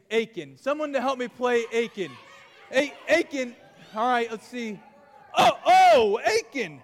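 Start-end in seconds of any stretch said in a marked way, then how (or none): 5.4-6.8 excited shout. Real, not acted. Spotting a volunteer, shouting.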